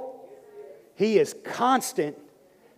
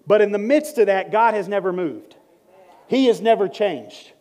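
ordinary talk

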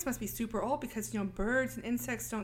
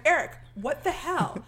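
angry outburst; 5 semitones higher, 6 dB louder than usual. Retold or imitated. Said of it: "and she's like".

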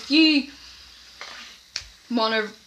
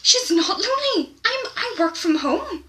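much higher, offended pitch, slowing on constantly; indignant high protest; 12 semitones higher, 3 dB louder than usual. Retold or imitated. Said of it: "I'm like".

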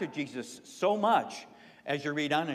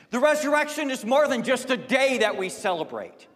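high, loud, stress on more; preaching Jesus' words; 9 semitones higher, 8 dB louder than usual. Acted. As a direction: drive the word more home. Preacher voices Jesus to congregation.

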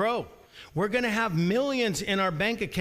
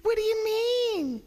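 put-on whiny kid protest; 13 semitones higher than usual. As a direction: whine like a kid caught out. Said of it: "have you ever heard that from your kids".

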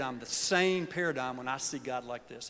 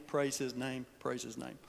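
fading praise; 3 semitones lower, 7 dB quieter than usual. Real, not acted. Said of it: "I praise his name".

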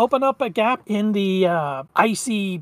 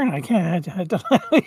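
normal talking voice

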